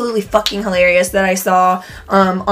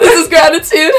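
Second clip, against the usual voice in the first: higher laughing echo; laughing squeal; 7 semitones higher, 4 dB louder than usual. Real, not acted.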